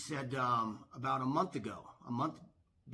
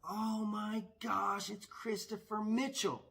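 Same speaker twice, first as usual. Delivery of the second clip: blurted amazement; 7 semitones higher, slower than usual. Retold or imitated. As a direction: imitate a fan blurting out in awe. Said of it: "blurt out".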